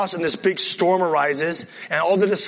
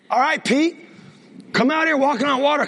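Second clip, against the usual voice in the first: calling out; 6 semitones higher than usual. Acted. Jesus calls Peter onto water.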